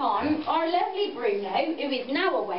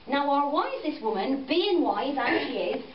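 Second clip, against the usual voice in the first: plain reading voice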